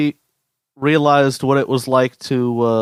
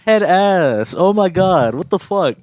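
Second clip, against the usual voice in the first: surprised; 6 semitones higher, slower than usual. Real, not acted. Reacting to friend's pun.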